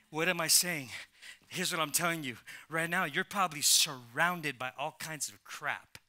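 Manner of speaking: usual speaking voice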